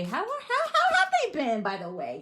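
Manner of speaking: high, giggly fake-casual question; mock casual fishing. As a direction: act innocent while prying, laughing. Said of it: "trying to be slick".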